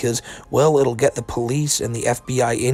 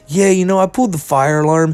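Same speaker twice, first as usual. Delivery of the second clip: higher, louder casual mock Daniel; imitating Daniel; 5 semitones higher, 6 dB louder than usual. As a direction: toss it off like it's nothing. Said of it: "Daniel ends up telling them".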